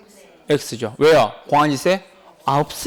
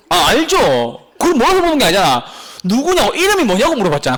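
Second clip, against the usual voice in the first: exasperated; 5 semitones higher, 5 dB louder than usual. Real, not acted. Teacher exasperated at wrong answer.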